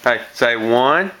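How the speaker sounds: slow drawn-out prompt; slow teaching. Shown as a demonstration. Parent teaching toddler to count, slow and drawn out. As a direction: spell it out slowly for the child. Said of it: "teaching toddler to count".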